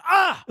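high-pitched mock yelp of pain; sudden high comic "ah"; imitated startled cry. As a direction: yelp like someone just bitten. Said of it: "he turns around and goes".

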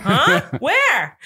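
high, loud, clueless questions; exaggerated puzzled cries; imitating someone baffled. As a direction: mimic being totally lost. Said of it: "he's like".

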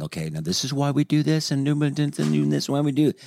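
over-explain like a new parent. Retold or imitated. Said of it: "parent explaining to first child".

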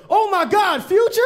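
high, excited squeal of recognition; drunk girl. Retold or imitated. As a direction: squeal it like a tipsy fan.

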